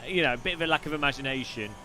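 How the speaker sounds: ordinary talk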